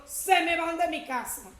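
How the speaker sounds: retold rebuke of evil spirits, loud and slow; commanding rebuke. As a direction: order the spirits out of the house with authority. Rebuking demons from the floor.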